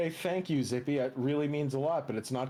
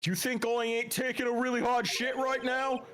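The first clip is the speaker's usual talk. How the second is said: strained, higher Jersey witness; thick Jersey accent; 8 semitones higher than usual. Acted. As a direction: gripe indignantly in thick Jersey. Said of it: "how uncomfortably thick his Jersey accent is".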